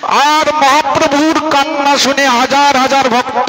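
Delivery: high declamatory narration, easing down at the end; impassioned preaching. A narrator's reading. Preacher describing thousands of weeping devotees, impassioned.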